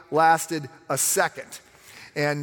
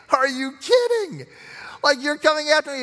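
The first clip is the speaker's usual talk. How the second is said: God laughing down at the nations, higher and louder; amused disbelief; 13 semitones higher, 5 dB louder than usual. Retold or imitated. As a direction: scoff like it's a joke.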